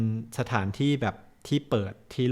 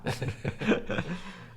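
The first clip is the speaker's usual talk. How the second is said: light high giggle; 8 semitones higher, 7 dB quieter than usual. Real, not acted. Joke about five baht toilet fee.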